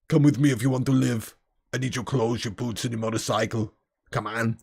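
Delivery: low, flat robotic Terminator voice; Terminator impression. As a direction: intone it like a deadpan killer cyborg. Quoting Terminator two lines.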